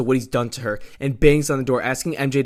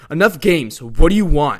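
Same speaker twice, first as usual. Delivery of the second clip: raised, loud and demanding; angry yell; 3 semitones higher, 9 dB louder than usual. A narrator's reading. Peter confronts the demon, angry yell. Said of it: "begins to yell at Mephisto".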